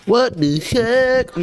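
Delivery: drawn out, high and slow; startled. Real, not acted. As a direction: stretch the shock out slowly. Startled reaction in game.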